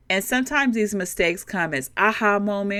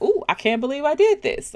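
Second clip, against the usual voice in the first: mock surprised reaction; 7 semitones higher, faster than usual. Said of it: "You're just reacting".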